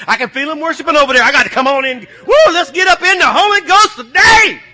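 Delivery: loud, high, fast preacher's call; shouting preacher revving up the room; fiery preaching. Real, not acted. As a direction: fire up the congregation. Preacher opening at full force.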